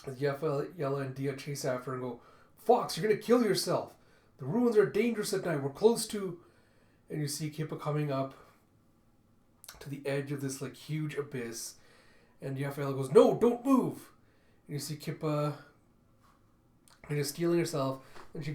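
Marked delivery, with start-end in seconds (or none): 2.6-6.4 high, loud urgent yell; shouted warning. Acted. Chasing the fox through the dangerous ruins, shouted warning. 13.1-14.1 high, loud, drawn-out yell; alarmed shout. Acted. Yafaela warning the fox at the abyss, alarmed shout.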